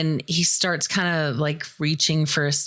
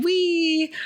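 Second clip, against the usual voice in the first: high, playful ride squeal; gleeful whee; 13 semitones higher than usual. Retold or imitated. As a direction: squeal it like a kid on a slide. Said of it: "screaming like it's a fun little ride".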